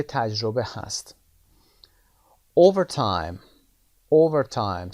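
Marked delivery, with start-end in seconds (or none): 2.6-4.9 Overtime with the opening o much louder; stress on O. Shown as a demonstration. Teacher demonstrates Overtime to students, stressing O. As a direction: punch the first o of Overtime. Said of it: "صدای او".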